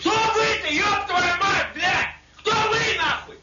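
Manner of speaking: high, loud and slow, every word bellowed; furious shout. Real, not acted. Soldier screaming at the man calling him plural, high and loud. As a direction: roar it back at him, outraged. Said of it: "что вы орёте-то?".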